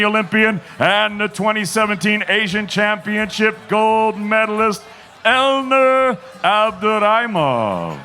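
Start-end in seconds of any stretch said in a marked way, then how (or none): 5.3-8.0 slow, stretched ring announcer call; drawn-out fighter introduction. Acted. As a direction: drag out the fighter's name.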